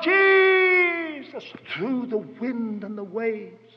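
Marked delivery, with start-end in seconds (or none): 0.0-1.4 high, long-drawn call; acted yell. Peter calling through wind and waves, drawn-out yell. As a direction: call out across a great distance. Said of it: "So he yelled".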